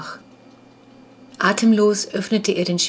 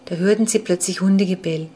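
plain reading voice

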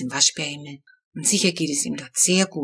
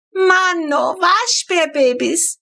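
much higher and louder, drawn out with delight; a little girl thrilled by baby raccoons; delighted squeal; 11 semitones higher, 4 dB louder, slower than usual. A narrator's reading. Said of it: "brüllt Flo entzückt".

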